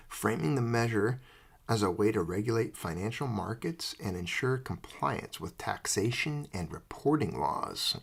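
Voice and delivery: normal talking voice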